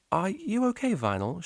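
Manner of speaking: a caring teacher checking in; teacher's voice. A narrator's reading.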